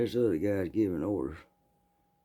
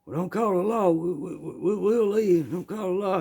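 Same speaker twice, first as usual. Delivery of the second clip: pleading thief; 9 semitones higher, 4 dB louder than usual. Retold or imitated.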